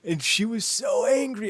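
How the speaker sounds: high and slow, stretched emphasis; emphatic. Real, not acted. A co-host describing the heroine, high and emphatic. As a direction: stress how angry she was.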